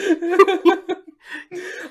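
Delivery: high, loud cackling fit; maniacal laughter. Real, not acted. Co-host anticipating where the topic leads, laughing maniacally. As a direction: crack up helplessly in a high cackle. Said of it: "you're maniacally laughing".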